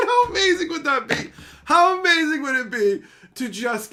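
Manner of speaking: high, loud laughing glee; high gleeful taunt; gleeful squeal. Real, not acted. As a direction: squeal with delight to taunt him.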